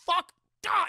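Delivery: very high, loud curse; furious outburst. Acted. Barbossa cursing at the wrong girl, a high loud outburst. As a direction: explode in frustration. Barbossa learns she's not the daughter.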